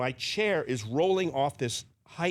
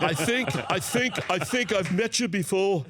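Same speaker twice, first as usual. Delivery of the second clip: playful celebrity voice; 7 semitones higher than usual. Retold or imitated. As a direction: lean into a curious rock star's voice. [name] voice retold.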